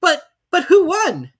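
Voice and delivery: very high, loud, slow question; panting question. Acted. Animals crowding the Dodo, high and loud. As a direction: ask eagerly, out of breath. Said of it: "panting and asking".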